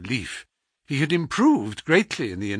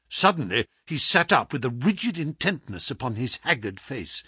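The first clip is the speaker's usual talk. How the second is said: plain reading voice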